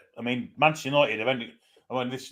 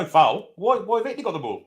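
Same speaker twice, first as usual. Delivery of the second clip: indignant protest; 6 semitones higher, 4 dB louder than usual. Real, not acted. Protests the referee's decision.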